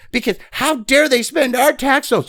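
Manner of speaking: high mocking imitation of indignant leftists; mock outrage. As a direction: sneer it in a shrill-pitched parody of their outrage. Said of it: "Because they were".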